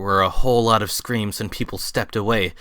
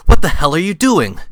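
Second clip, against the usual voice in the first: high, loud outraged question; angry demand; 6 semitones higher, 10 dB louder than usual. Acted. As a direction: shout at him to stop. Zack confronts Watson torturing Wiseau.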